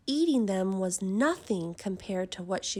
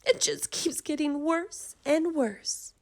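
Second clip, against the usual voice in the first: despairing mother; 6 semitones higher than usual. A narrator's reading. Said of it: "cried Missus Cream".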